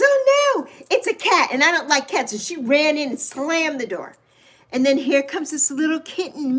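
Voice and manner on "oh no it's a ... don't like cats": alarmed neighbor. Retold or imitated. Neighbor spotting a cat at the steps, mock alarm. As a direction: imitate a neighbor put off by a cat.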